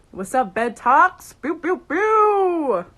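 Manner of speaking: loud, stretched-out call rising high; hype-host shout. Pretend. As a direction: whoop it like a party horn. Hype call with imitated horn.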